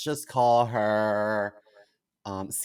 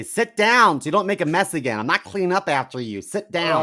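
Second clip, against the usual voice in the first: loud, raised-pitch order to a husband; bossy scolding; 5 semitones higher, 7 dB louder than usual. Retold or imitated. Spouse telling hubby how to use the toilet, sharp nagging. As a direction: lay down the law, punching the commands. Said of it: "I tell the hubby, I say".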